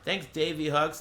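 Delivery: ordinary talk, nothing marked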